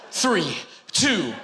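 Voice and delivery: countdown that jumps way up into a slow shouted punchline; announcer shouting the final-second shot; buzzer countdown. Pretend. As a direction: call it like the last shot at the buzzer. Countdown buzzer-beater call.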